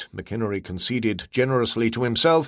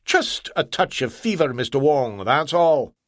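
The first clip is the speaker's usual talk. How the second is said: put-on Scottish accent; 3 semitones higher than usual. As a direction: brush it off in Scots. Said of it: "phony accent".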